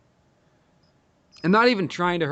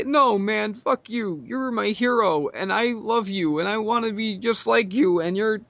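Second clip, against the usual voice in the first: mock crying friend; 6 semitones higher, slower than usual. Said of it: "crying, and he's like".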